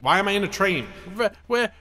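normal talking voice

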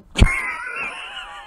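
sudden high shriek of laughter; real high-pitched laughing shriek. Real, not acted. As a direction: squeal with laughter. Laughs at lookalike.

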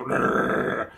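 harsh mouth-made distortion noise; imitated audio peaking. Streamer showing how the recording peaks, imitated distortion. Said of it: "like, really loud and really".

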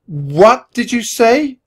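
loud, high, drawn-out demand; shocked outburst. A narrator's reading. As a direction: blurt it in slow, stunned disbelief. Shocked brother confronts his sister.

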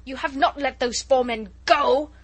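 loud menacing roar; thundering menace. Acted. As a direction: thunder the accusation at the soldiers. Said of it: "thundered Chauvelin menacingly".

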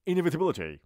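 Inevitability run together quickly, high and loud; quick, punched Inevitability; quick demo. Shown as a demonstration. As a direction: rattle off Inevitability with the middle squeezed. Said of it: "اندکی تند و با این لحن".

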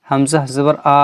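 final আ stretched long; wrongly drawn-out vowel. Shown as a demonstration. Teacher shows how not to read it, drawn-out final vowel. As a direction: drag out the last আ in Hamza জবর আ. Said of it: "কিন্তু এরকম পড়বো না".

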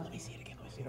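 whisper. Retold or imitated. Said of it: "She's like".

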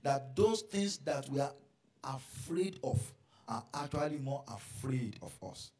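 loud, driving sermon delivery; forceful preaching. Acted. Preacher driving home the lesson loudly to the church. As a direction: preach it out to the congregation.

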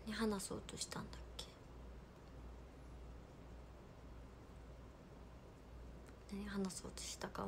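whispering to herself; hushed mutter while thinking. Real, not acted. Streamer whispering to herself, trying to remember, whispering. Forgot what she meant to say.

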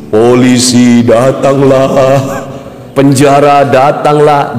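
slow, drawn-out dread; a slow hint of doom; slow mock dread. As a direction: stretch each word ominously. Quoting old bad words.

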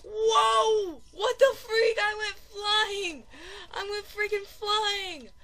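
high, loud disbelief; shocked outcry. Real, not acted. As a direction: stretch it out in alarm. Launched off in the game.

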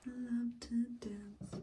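slow breathy whisper. Shown as a demonstration. Vlogger softly whispering that she loves to dance. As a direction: murmur it like a secret. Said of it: "repeats line in a whisper".

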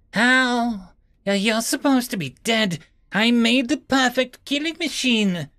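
a trembling captor caught out, a touch afraid; stammering. A narrator's reading. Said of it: "the creature stammered".

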